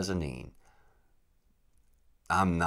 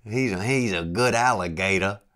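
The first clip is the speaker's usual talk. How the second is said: failed Louisiana imitation, louder; Louisiana accent attempt; 4 semitones higher, 9 dB louder than usual. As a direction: try the Cajun guide's praise and miss. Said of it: "I can't do it".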